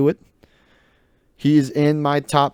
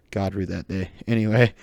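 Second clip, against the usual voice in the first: ordinary delivery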